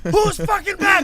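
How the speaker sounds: high, loud echo of the guard's shout; panicked yelling. Retold or imitated. Storyteller echoing the guard's shouting at the airport, loud panicked yell. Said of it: "I was like".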